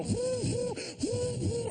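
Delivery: high siren whoops; mock siren whoop. The cop car pulling up, high siren whoops. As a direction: wail like a police siren.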